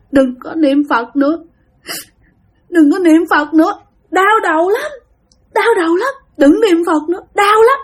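high, whimpering mock sob; retold high-pitched tearful begging; imitated crying. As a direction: act out a tearful plea in pain. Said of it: "bắt đầu khóc".

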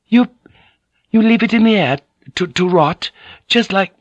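high, faltering disbelief; halting, horrified lady's voice, pitched right up; appalled stammering. A narrator's reading. Mariko appalled at rotting meat.

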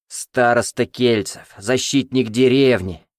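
sarcastic. Acted. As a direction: proclaim it with scorn. Zhdan mocking publicly. (саркастическое восклицание)

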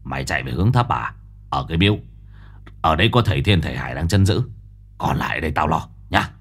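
cold gruff man. Acted. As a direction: give orders coldly and fast. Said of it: "hừ lạnh".

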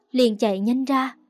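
usual speaking voice

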